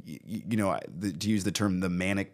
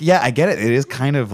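ordinary talk

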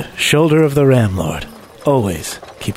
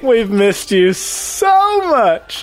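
high, slow, over-the-top gushing; 9 semitones higher, slower than usual. Acted. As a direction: smother him with fond greeting.

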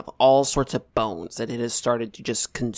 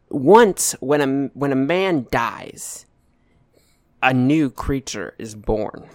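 slow dramatic narration; slower than usual. Acted.

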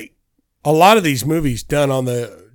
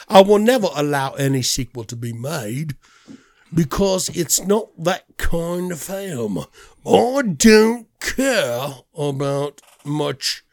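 put-on Guy Ritchie-movie accent. Quoting the director on sequels, mock British accent. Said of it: "You sound like you're in a Guy Ritchie movie".

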